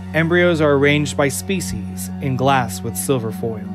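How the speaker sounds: ordinary talk, nothing marked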